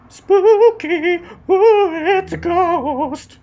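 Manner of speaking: very high, loud, slow ghostly wail; spooky ghost voice. Acted. Showing the ghost card to viewers, a high loud spooky wail.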